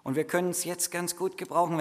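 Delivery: ordinary delivery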